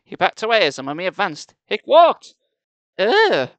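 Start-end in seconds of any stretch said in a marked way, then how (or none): 1.7-2.3 very loud high yell; startled high cry; alarmed shout. Acted. Uncle backs from advancing mummy, shouting. 3.0-3.5 high, loud startled groan; involuntary yelp; loud "ugh" cry. Acted. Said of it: "escaped Sari's lips".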